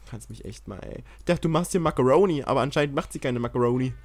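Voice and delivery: usual speaking voice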